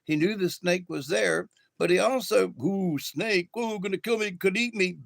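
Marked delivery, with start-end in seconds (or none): 2.6-5.0 light, higher-pitched pretend fright; mock fear. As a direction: playfully act out a scared lizard. Lizard's imagined fear of snake.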